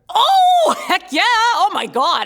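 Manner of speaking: loud, high whoop of recognition; excited blurting. Acted. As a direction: jump in with an over-eager yes. Blurts out answer in office.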